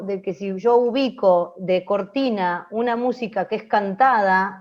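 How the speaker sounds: ordinary delivery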